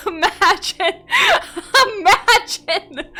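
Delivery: high, loud laugh into the words; laughing hard. Real, not acted. Streamer breaking up at her story, laughing. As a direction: cackle through it.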